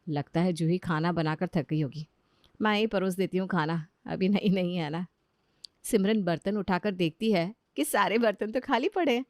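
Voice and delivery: ordinary delivery, nothing marked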